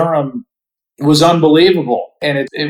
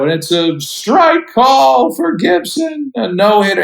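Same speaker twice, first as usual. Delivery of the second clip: higher and slow, choking back tears as he calls it; a moved announcer's tearful no-hitter call; voice cracking with emotion; 9 semitones higher than usual. Retold or imitated. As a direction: imitate dad's broadcast call, voice breaking. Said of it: "he's choking back tears".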